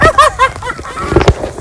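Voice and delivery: high, loud burst of laughter. Real, not acted. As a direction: laugh out hard. Big laugh.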